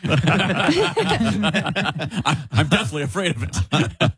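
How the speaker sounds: high-pitched joking protest through laughter; laughing mock denial. Co-host joking he wouldn't wait in rain, laughing denial. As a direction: deny it playfully, cracking up.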